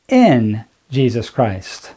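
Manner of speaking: "in" drawn out slowly and weighted; slow right down and press on "in"; stress on IN. Shown as a demonstration. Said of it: "I'm gonna put emphasis on a word, and the word is in".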